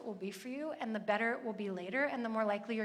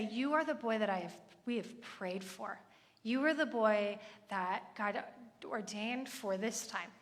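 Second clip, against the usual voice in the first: heartfelt blessing; 2 semitones lower than usual. Shown as a demonstration. Mother to son at bedtime, slow tender blessing. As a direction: let each phrase sink in, lovingly. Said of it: "really celebrate my son in that time".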